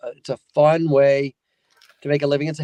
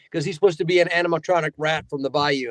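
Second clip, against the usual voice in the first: normal talking voice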